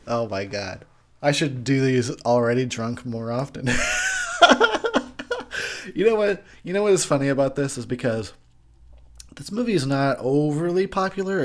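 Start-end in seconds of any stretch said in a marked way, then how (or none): 3.7-5.9 very high, loud cackling laugh. Real, not acted. Tipsy reviewer cracking up at his own pun.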